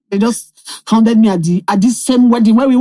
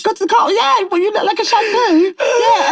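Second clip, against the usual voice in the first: mock British accent, higher, laughing through it; best British accent; 8 semitones higher than usual. Putting on a British accent so the driver hears no insult. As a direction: fake a posh British accent to soften the insult. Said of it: "just say it in your best British accent".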